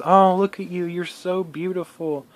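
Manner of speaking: high, gushing praise; gushing mom. Retold or imitated. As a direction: mimic a mother gushing over the girl. Mother gushing over the girlfriend.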